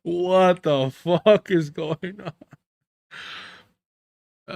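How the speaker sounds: very slow, stretched-out words; a hammed-up slow "what is going on"; mock bewildered drawl. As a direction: drag out the confusion for comic effect. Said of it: "He's like".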